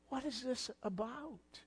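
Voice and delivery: soft, almost breathed, inward asking; hushed question. Retold or imitated. Preacher asking the question again quietly to the congregation. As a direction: drop it to a quiet wondering. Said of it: "We have to ask the question".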